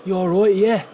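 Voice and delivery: badly faked Dublin accent; attempted Dublin accent. Shown as a demonstration. Streamer having a go for chat, attempted Dublin accent. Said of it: "I can't really do a Dublin accent".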